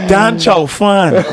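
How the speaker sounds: "Don Chow Fan" said slow and high through laughter; laughing. Shown as a demonstration. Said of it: "That's my favorite".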